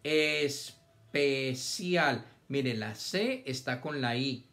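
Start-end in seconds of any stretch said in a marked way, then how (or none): 0.0-2.2 very slow, es-pe-cial split into syllables; sounded out syllable by syllable. Shown as a demonstration. Teacher reading aloud to young pupils, syllable by syllable. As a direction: stretch each syllable apart for the children. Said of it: "Leer es hacer los sonidos todos pegaditos".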